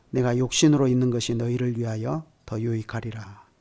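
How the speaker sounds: normal talking voice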